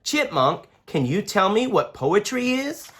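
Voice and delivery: put-on bright curious kid asking; young boy Daniel. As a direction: ask like a curious little boy. Daniel child voice.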